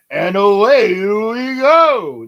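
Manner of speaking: loud, long-stretched showman catchphrase; Jackie Gleason. Acted. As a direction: belt it out, drawing out the vowels. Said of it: "Jackie Gleason was".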